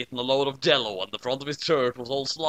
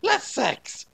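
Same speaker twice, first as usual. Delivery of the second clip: erratic; 10 semitones higher than usual. A narrator's reading. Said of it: "she said erratically".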